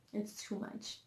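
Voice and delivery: barely voiced, worn-out whisper. Real, not acted. Telling viewers about church, a worn-out whisper. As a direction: say it on almost no voice, drained.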